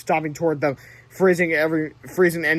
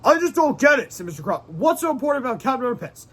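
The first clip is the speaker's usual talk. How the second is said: puzzled principal; 7 semitones higher than usual. Acted. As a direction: ask it like a confused grump.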